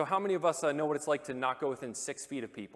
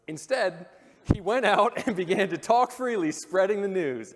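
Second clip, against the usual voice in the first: louder and higher, laughing through it; excited reading; 7 semitones higher, 10 dB louder than usual. Real, not acted. Preacher reads how the healed man spread the news, loud and laughing. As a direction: read it with gleeful delight. Reading the leper's joyful disobedience.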